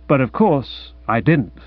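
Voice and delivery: usual speaking voice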